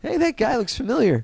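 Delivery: high-pitched movie-kid impression; Michael J. Fox as Marty McFly. Marty spotting a familiar face in another dimension, high impression. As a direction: go way up, puzzled recognition. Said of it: "You do a great Michael J. Fox".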